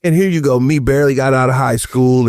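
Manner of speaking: ordinary talk, nothing marked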